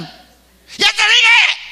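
high, loud, drawn-out macho yell; mock bullying shout. Preacher mimics machos who shout and manipulate, hammed-up bullying yell. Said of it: "y gritan, manipulan".

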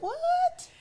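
mock startled squeak; shocked what. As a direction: yelp it in disbelief.

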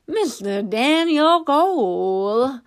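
slow drawn-out tipsy greeting; slow tipsy drawl on Cole. A narrator's reading. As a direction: drawl the name like a genteel drunk. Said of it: "drawling out the "ole" on Cole".

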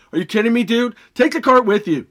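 mock-furious rant at a careless shopper; angry yell. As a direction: yell at the cart-leaver like he's right there. Ranting at shoppers leaving carts.

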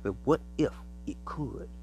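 ordinary talk

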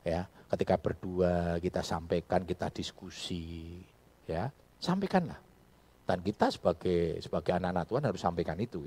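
ordinary talk, nothing marked

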